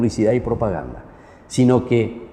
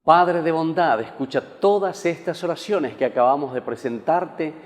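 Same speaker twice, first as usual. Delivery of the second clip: liturgical prayer; 4 semitones higher than usual. Acted. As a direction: intone it like a priest at mass. Priest intones liturgical prayer.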